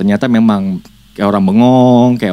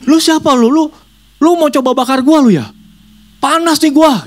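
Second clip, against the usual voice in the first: very high, hot and angry; demon voice. Acted. Demon accusing the boy of burning it, high angry. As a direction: spirit feeling burned, lashing out. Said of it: "Dia ngomong nih yang serupan".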